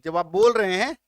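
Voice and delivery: usual speaking voice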